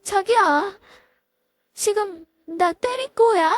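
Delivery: high, stunned and halting; a dazed woman stammers at the man who hit her; stammering in shock. A narrator's reading. Slapped ex-wife stammers to her lover, high and stunned. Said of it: "더듬거리며 입을 열었습니다".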